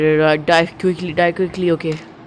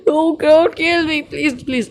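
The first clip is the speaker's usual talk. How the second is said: desperate wail; 9 semitones higher, slower than usual. Real, not acted. Gamer in a failing fight, desperate high wail. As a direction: whine and beg as the panic drains. Despairing plea during firefight.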